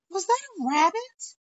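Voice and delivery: sudden high-pitched double take; startled surprise. Real, not acted. Startled by rabbit outside window.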